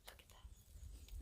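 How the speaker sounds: soft breathy whisper. Real, not acted. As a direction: hush it in awe. Awed whisper at the forest.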